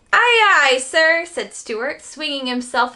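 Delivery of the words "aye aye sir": loud, much higher sailor's reply; eager aye aye. Acted. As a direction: snap to it like a keen deckhand. Eager sailor answering order.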